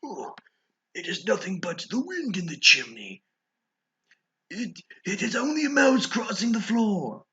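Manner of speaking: put-on high, anxious old man comforting himself; frightened old man. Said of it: "He had been saying to himself".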